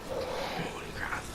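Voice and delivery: breathy hushed exclamation under the breath; whispered aside. Real, not acted. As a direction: mutter it to yourself, barely audible. Sudden aside mid-story, whispered.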